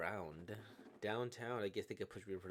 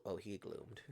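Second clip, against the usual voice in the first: normal talking voice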